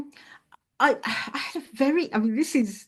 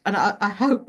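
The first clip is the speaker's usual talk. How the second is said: ordinary talk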